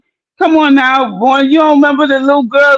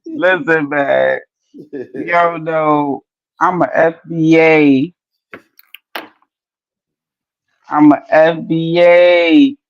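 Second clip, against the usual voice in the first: mock solemn; 6 semitones lower, much slower than usual. Real, not acted. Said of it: "mock solemn claim".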